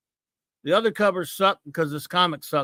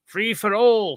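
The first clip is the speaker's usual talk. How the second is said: quoting the episode; 3 semitones higher, 3 dB louder, slower than usual. Retold or imitated. Reviewer lightly voicing a campaign chant from the Free for All episode. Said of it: "Hey, from Free for All, look".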